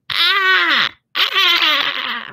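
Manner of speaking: high, loud cartoon sponge squeal; SpongeBob voice. Shown as a demonstration. Voice teacher demonstrating SpongeBob to a student, high loud squeal. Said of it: "SpongeBob is very".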